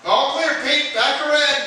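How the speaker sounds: high, loud call to the driver. Acted. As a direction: shout directions across the garage. Fireman calling out to driver.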